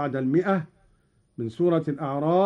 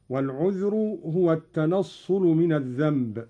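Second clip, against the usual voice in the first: ordinary delivery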